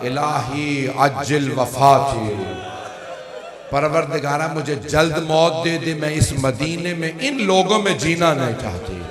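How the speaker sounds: loud, lifted anguished cry to God; anguished plea. Acted. The Lady begging God for death, loud anguished plea. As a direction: cry out for release, voice lifted high.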